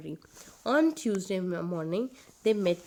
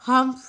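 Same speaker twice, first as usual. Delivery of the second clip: louder "Humph" grunt, dismissive; rude humph; 5 semitones higher, 10 dB louder than usual. A narrator's reading. Camel rudely grunts humph to everyone. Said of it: "replied in a very rude manner".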